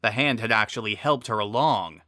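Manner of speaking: usual speaking voice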